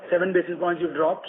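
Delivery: ordinary talk, nothing marked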